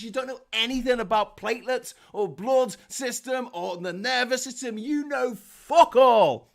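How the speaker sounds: loud, exasperated rant building to a shout; angry telling-off. Acted. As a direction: tear into a know-nothing, high and loud. Acted telling-off of anti-vaxxers.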